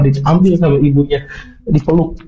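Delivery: normal talking voice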